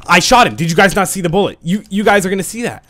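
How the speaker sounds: loud, high indignant complaint; loud, high-pitched disbelief at a shot that didn't count; outraged protest. Real, not acted. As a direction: insist to the viewers that it hit. Protesting a missed kill.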